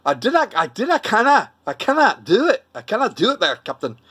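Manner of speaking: high, swooping mock Glaswegian; Glasgow accent. Asked if they speak Glasgow, hammed-up Glaswegian. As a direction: put on a hammed-up Scottish brogue with rolled R's. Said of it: "do you speak Glasgow".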